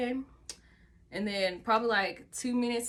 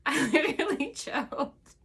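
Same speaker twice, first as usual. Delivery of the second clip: said through laughter, higher and louder; laughing; 7 semitones higher, 6 dB louder than usual. Real, not acted. Speaker telling viewers she choked, laughing. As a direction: laugh while admitting it.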